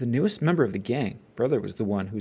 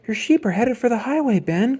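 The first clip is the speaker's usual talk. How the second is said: alarmed cry; 11 semitones higher, 5 dB louder than usual. A narrator's reading. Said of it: "cried Brother".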